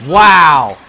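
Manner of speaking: loud startled wow; surprised wow. Real, not acted. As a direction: burst out in shock. Reacting to game.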